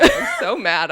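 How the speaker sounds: high, loud, laughing; flustered, laughing about the spilled kombucha; laughing frustration. Real, not acted. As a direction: laugh through being flustered and mad. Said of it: "in a tizzy".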